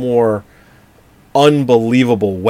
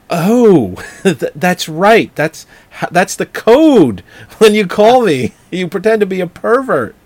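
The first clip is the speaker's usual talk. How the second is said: higher, slow mock-sweet voice; girlfriend voice; 8 semitones higher, 4 dB louder, slower than usual. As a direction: play the coy girlfriend, hammed. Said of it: "And then she's like".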